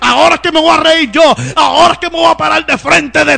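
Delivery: fervent Pentecostal preaching, loud and driving; preaching at full force. Acted. Preacher rousing the congregation about finances, full-force preaching.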